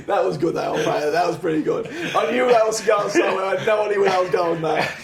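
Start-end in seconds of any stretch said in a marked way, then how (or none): 1.9-4.9 excited laughing reaction. Real, not acted.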